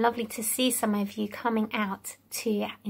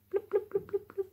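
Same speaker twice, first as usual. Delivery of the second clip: fish bloop; 8 semitones higher, 9 dB quieter, faster than usual. Shown as a demonstration. Fish sound echo, high soft.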